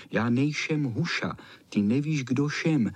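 s said as sh in "nejšem huša"; lisping. Acted. The flea retorts from the rising water, lisping "nejšem huša". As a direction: lisp "šem" with sh for s. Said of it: "šišlalo to".

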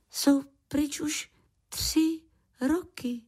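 put-on high, slow child's reproach; reproachful. A narrator's reading. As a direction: say it as a hurt little one, pitched high. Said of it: "zazněla v jeho hlase výčitka".

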